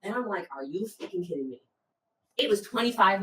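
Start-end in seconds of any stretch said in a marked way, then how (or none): 0.5-1.6 low-volume, understated exasperation; hushed disbelief. Retold or imitated. To a partner when someone quits early, hushed disbelief.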